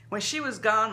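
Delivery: usual speaking voice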